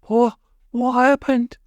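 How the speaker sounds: high, slow dazed question; confused. A narrator's reading. Polly after landing in the well, high and confused. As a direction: ask it bewildered after the fall. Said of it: "asked Polly in confusion".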